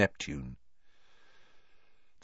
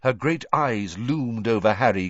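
usual speaking voice

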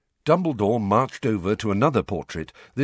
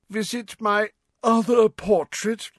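put-on higher, slow, reedy old wizard; thin voice with a weary sigh; 13 semitones higher than usual. A narrator's reading. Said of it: "said Phineas in a reedy voice".

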